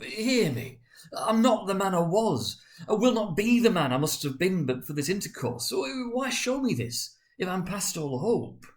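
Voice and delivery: high, loud anguished pleading; desperate plea. Acted. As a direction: beg as if your life depends on it. Scrooge begging the ghost.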